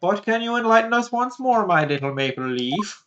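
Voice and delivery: put-on Canadian accent that slips Irish; a bad Canadian accent. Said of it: "very Irish when you do your Canadian accent".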